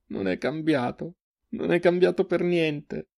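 high, tearful lament, drawn out; broken voice. A narrator's reading. Crying sister to her brother, broken voice. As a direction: say it high and slow, voice breaking. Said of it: "la voce rotta".

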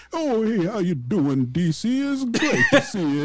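put-on high, bubbly old security guard; chattering melting snowman voice. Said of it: "Talks like the snowman from".

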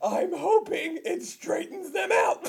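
deluded son bragging through laughs; hammed-up high son's boast; whiny son voice. Continuing the son role.

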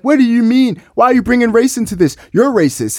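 a hint of a heckler turning it around; mock accusing protester. Protesters accuse him back.

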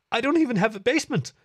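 high, loud, fast frightened cut-in; panicky interruption. A narrator's reading. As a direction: talk over him in a rush of fear. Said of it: "she talked over him".

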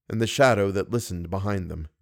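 plain reading voice

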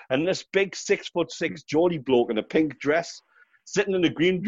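ordinary delivery

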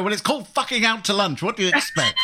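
high, exasperated comeback; exasperated retort. Retold or imitated. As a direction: snap back in mock exasperation. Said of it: "And I go".

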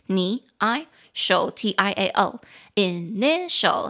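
"initial" broken slowly into its three syllables; very slow teaching breakdown of "initial"; syllable-by-syllable demonstration. Shown as a demonstration. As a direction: sound out "initial" piece by piece, spelling as you go. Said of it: "三个音节".

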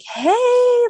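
imitated chirpy greeting, very high and loud; all chipper. Mom answering her daughter's call, all chipper. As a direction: sing out a high, drawn-out mock-chipper hello. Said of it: "like, all chipper".

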